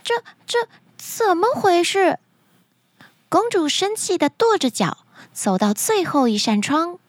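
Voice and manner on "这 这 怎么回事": high, stammering dismay; flustered princess. Acted. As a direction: stumble on the first word, pitch up high in panic. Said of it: "她又慌了".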